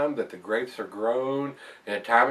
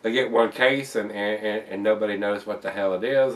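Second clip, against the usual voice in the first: ordinary talk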